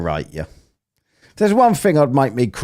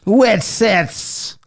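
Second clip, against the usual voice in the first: a higher, mocking parody of his pronunciation; mocking imitation; 9 semitones higher than usual. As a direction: mimic him to make him sound silly. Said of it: "Why does he talk like a bellend?".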